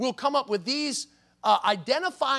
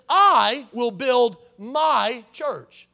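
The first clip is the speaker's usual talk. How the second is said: "I will build my church" very slow and louder, each word weighted; emphasized words; 7 dB louder, much slower than usual. Shown as a demonstration. Pastor repeating the verse with every word stressed, slow. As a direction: drive every word home slowly. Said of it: "those words that we just emphasized".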